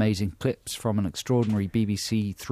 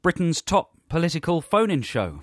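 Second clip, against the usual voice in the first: higher-pitched, punchy radio host parody; mock phone-in host; 5 semitones higher than usual. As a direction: pose loaded questions like a political phone-in presenter.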